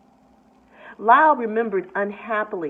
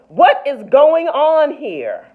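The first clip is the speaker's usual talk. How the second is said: loud, slow, furious demand; furious shout; 6 semitones higher, 9 dB louder, slower than usual. A narrator's reading. Store manager shouting at the scene, furious. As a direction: bellow it at the whole crowd. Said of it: "voice charged with fury".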